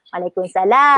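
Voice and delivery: Waalaikumsalam with "laam" held long and rising at the end; elongated stop. Shown as a demonstration. Teacher showing tajwid on stopping, long final "salaam". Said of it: "kalau kita stop, dari segi tajwid dia".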